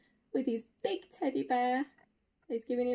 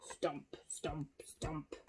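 hushed, spaced-out whisper; whispered stomping; 9 semitones lower, 16 dB quieter, much slower than usual. Acted. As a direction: whisper each footstep ominously for the children. Acting out stomping towards them.

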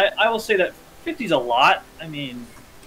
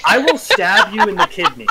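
loud, high mock threat; 6 semitones higher, 5 dB louder than usual. Joking back at a friend's Dirk, mock threat. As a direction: threaten playfully, raised in pitch and volume.

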